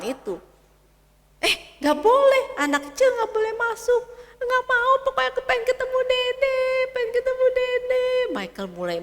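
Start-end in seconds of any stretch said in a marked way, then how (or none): 1.4-4.0 high, loud scolding nurse; nurse forbidding. Acted. Suster melarang anak masuk. 4.4-8.1 put-on high, loud, fast child's whine; a stubborn boy insisting, near tantrum; whiny little boy. Anak merengek lagi.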